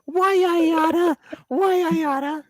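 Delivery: high, squeaky cartoon threat; cartoon grump. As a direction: shake a fist like a cartoon.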